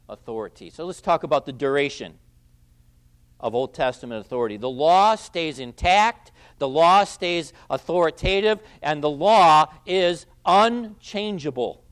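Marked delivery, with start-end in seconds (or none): 4.6-11.8 raised, slow and insistent, hammering each clause; emphatic preaching. Real, not acted. Preacher to the congregation on the law, slow, emphatic rise. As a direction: pound home that the law stands firm. Preacher stressing the law's permanence.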